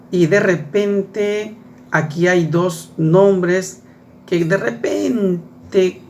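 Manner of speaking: drawn out slowly, thinking aloud; slow pondering. Acted. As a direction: stretch "de repente" out as you puzzle. Teacher ponders unfamiliar names.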